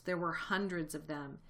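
plain reading voice

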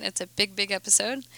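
ordinary delivery

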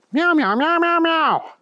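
high, running string of meows; meowing kitty. Acted. Thunder Kitty answering the police chief, meowing. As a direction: chatter away in cat meows. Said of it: "said Thunder Kitty".

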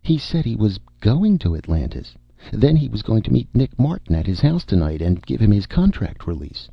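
high, uncertain star voice; vague, dim film star. Acted.

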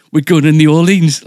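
imitating a loud, high-pitched excited announcement. Wife announcing a surprise birthday trip, excited. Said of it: "like that".

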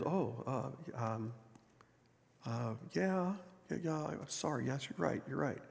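barely above a murmur, lost for words after a death; grieving quiet apology. Acted.

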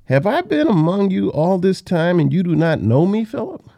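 high-pitched, exasperated disbelief. Acted. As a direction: reproach a pupil who still hasn't got it. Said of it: "he's exasperated in his tone".

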